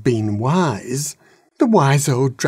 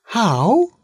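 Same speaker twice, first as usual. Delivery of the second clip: put-on baby dragon; 10 semitones higher than usual. A narrator's reading. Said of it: "asked the baby dragon".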